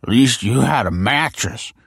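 put-on slurring drunk. A narrator's reading. Drunk Tony defends the home he gave, slurred and indignant. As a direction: slur it like an aggrieved drunk. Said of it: "he slurred".

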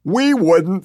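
high, loud defiant shout; yelled back. A narrator's reading. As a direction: yell it straight back. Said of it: "they both yell back in unison".

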